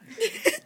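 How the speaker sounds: brief, high closing laugh; short high giggle. Real, not acted. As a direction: let out a quick high giggle. Laughs after ending the story.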